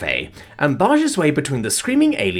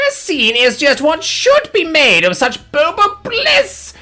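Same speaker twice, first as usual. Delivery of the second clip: much higher, louder, slow booming exultation; grand alien proclamation; 6 dB louder, slower than usual. A narrator's reading. Alien proclaiming his boba bliss to the whole cafe, exultant and high. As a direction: proclaim it to the heavens. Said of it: "cry it out to the heavens".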